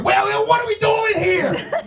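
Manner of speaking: comic rant at the Subway worker; exasperated shout. Retold or imitated. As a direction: throw the question at her in disbelief. Ranting at the sandwich clerk.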